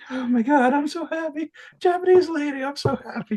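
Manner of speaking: hammed-up joy at the shop counter; overjoyed. Retelling joy to the shop lady.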